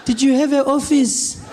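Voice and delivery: high, slow, disbelieving granny; slow and high like an old lady setting him straight; loud slow granny correcting her grandson. Retold or imitated. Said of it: "She's like".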